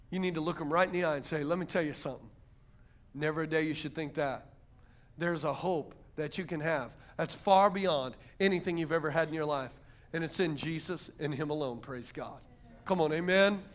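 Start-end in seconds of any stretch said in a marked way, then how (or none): 1.4-12.4 low, soft and steady; earnest reassurance. Acted. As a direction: speak quietly, straight to someone who is hurting. Counselling the despairing person eye to eye.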